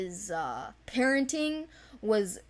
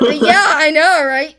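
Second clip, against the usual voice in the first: real laughter through the words, much louder; laughing; 7 semitones higher, 17 dB louder than usual. Real, not acted. Laughing along with her co-host, loud and giddy.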